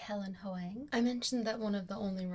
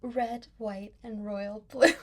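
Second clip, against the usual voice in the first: loud, breaking into laughter at the end; barely straight face; 9 dB louder, slower than usual. Real, not acted. Vlogger reads out book title, cracking up. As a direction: try to keep a straight face and fail. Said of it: "can't say with a straight face".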